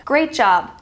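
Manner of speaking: usual speaking voice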